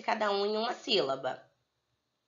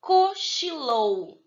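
"Cochilou" very slowly, higher, split into co, chi, lou; syllable by syllable; 6 semitones higher, 4 dB louder, much slower than usual. Shown as a demonstration. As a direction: break "Cochilou" into three drawn-out syllables. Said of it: "três sílabas". ("Cochilou" bem devagar, separando co, chi, lou)

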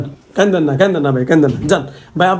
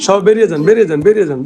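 loud commanding shout to clear room; shouting orders; 3 semitones higher, 11 dB louder, faster than usual. Real, not acted. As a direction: order everyone out at full voice. Ordering everyone out. (সবাইকে জোরে ধমক দিয়ে বের হতে বলা)

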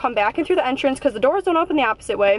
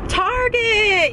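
higher, cheerful call-out; excited call-out; 8 semitones higher than usual. Real, not acted. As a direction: sing out the store name gleefully. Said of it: "Come on, say it with me".

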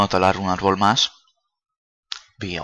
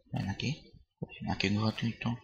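ordinary talk, nothing marked

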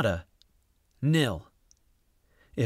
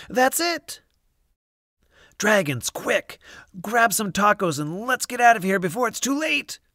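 much higher, urgent shout; rally the dragons fast; excited urgent call; 10 semitones higher than usual. A narrator's reading. Triumph then urgent call.

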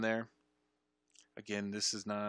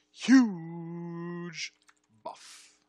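very loud, high, long-held HUGE; emphatic excitement; 7 semitones higher, 11 dB louder than usual. Real, not acted. Player hailing favorite character's buff, loudly thrilled. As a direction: belt out the word and stretch it.

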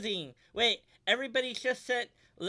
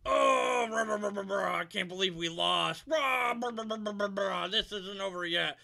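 sputtering foiled villain; 4 dB louder than usual. Acted. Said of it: "have him say something about losing".

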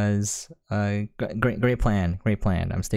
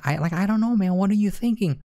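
pitched way up in exasperation; can't believe how dumb this plan is; exasperated disbelief; 10 semitones higher than usual. Real, not acted.

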